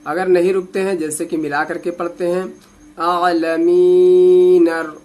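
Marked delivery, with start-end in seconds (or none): 3.0-4.9 आलमीन अर linked, the नून running into रा; joined recitation. Shown as a demonstration. Teacher showing how to link the verse without pausing. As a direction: glide आलमीन straight into अर. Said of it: "नून को रा से मिलाना है".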